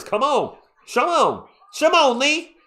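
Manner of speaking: rising from eager to loud high pleading cheer; building urging. Real, not acted. Fan cheering on the Spurs, building from eager to loud high cheer. Urging team during run.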